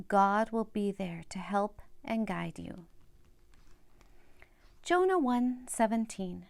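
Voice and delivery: usual speaking voice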